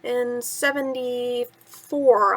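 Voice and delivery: plain reading voice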